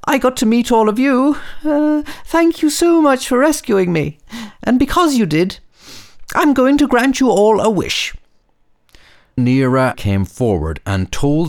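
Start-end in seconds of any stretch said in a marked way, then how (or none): 0.1-8.1 very high cheerful little voice; squeaky high tiny star; sparkly tinkling star voice. Acted. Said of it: "said the star".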